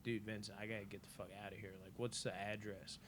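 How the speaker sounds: retold furtive undertone; hushed urgency. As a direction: secretly plead with a friend for help. Secretly asking friend for escape.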